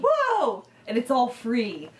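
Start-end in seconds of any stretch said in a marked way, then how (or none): 0.1-0.6 a high, amazed "Whoa!"; surprised whoa. Retold or imitated. Friend reacts to a magic taxidermy land with a high whoa. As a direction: gasp it out, wide-eyed.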